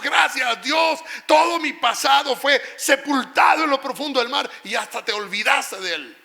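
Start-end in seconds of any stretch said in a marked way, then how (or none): 0.0-4.5 high, loud and slow, proclaiming gratitude; joyful shout of thanks. Retold or imitated. Congregant shouting thanks to God, loud and slow. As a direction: shout it out like a freed believer.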